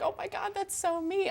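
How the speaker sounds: high, gushing "oh my God"; lightly voiced fangirl gasp; excited squeal. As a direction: squeal with delight at recognizing yourself. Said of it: "and I'm like".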